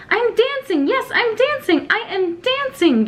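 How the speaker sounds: high, delighted shout of joy; overjoyed, can't believe it; joyful cry. Acted. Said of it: "Gerald cried".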